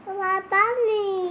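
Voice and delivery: usual speaking voice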